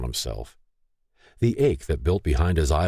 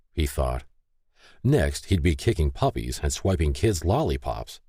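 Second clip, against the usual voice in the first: plain reading voice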